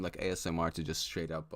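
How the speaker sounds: usual speaking voice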